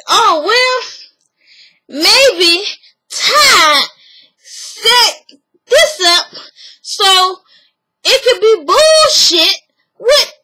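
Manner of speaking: lightly voiced Bertha; mock theorizing. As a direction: spin the theory slowly and knowingly. Bertha floats a conspiracy theory.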